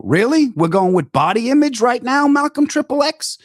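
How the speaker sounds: high incredulous comeback; mock outrage. Answering Patrice's fat jab with high mock outrage. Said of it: "I go".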